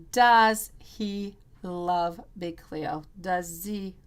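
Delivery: slow, hushed question to the spirits; slow incantation. Real, not acted. Tarot reader asks the spirits with the pendulum, slow and hushed.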